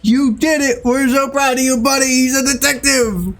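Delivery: high, proud cheering; excited praise. Real, not acted. Streamer celebrating the AI detective's win, proud and excited. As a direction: cheer him on like a proud parent.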